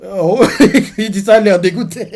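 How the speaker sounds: high, loud laughing exclamation. Real, not acted. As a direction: burst out laughing through the words. Amused reacting to the scene.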